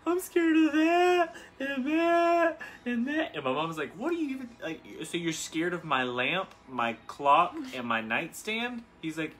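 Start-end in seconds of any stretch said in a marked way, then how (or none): 0.1-3.4 high, loud, drawn-out mock wail; exaggerated bawling, stretched way up high; crying, freaking out. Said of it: "freaking out, like, crying super hard". 3.9-8.8 baffled mom. Retold or imitated. Mom baffled by son's fears.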